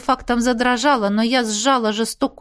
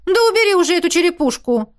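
higher exasperated demand; fed-up friend demanding; indignant outburst; 7 semitones higher, 3 dB louder, faster than usual. A narrator's reading. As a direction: snap at her to drop it. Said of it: "возмутилась Миранда".

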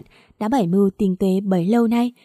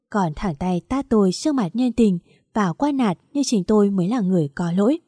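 ordinary delivery, nothing marked

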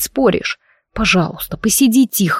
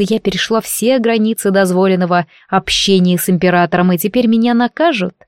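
usual speaking voice

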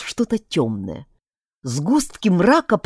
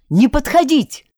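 higher, louder command; shout; 8 semitones higher, 5 dB louder than usual. A narrator's reading. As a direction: yell a warning. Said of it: "крикнул Ромашка". (громко крикнуть)

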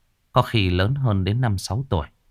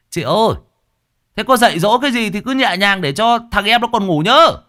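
cheeky grinning tease; 13 semitones higher, 6 dB louder than usual. A narrator's reading. Long calling after Hương as she drags Hải away, cheeky teasing. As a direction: tease her with a grin. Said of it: "thằng Long cười nham nhở".

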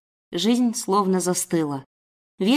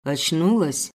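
low voice of a menacing captor; grudging, muttered sneer; 4 semitones lower, slower than usual. Acted. Kidnapper to waking captive, low and grudging. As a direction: squeeze the word out coldly, low. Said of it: "окинул меня пристальным взглядом и процедил".

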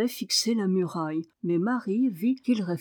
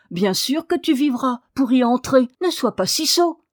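loud, high, scolding reassurance; impatient, no-nonsense rebuke; brusque; 5 semitones higher, 5 dB louder than usual. A narrator's reading. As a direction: tell him off firmly. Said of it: "dit rudement Marie".

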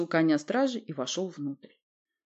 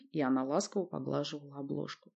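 usual speaking voice

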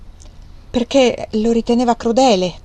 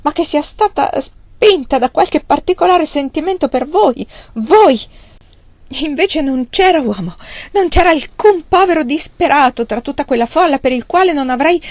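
higher, louder, voice choked by held-back tears; 6 semitones higher, 3 dB louder than usual. Acted. Margaret protesting to Thornton, choked with tears. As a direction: fight the tears as the words break through. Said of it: "le strozzarono la voce".

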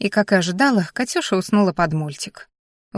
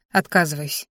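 plain reading voice

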